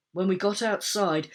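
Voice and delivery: ordinary delivery, nothing marked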